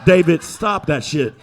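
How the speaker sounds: loud, slow telling-off; loud mock reprimand; mock scold. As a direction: snap at your friend to quit it. Scolding friend on stage.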